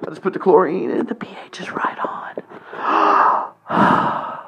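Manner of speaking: whispered aside after the shouting; mock whisper. Host whispers about the pool's chlorine after shouting. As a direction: drop to a hushed whisper. Sudden hush after frenzy.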